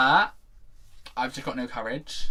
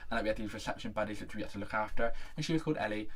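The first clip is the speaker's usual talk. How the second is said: ordinary delivery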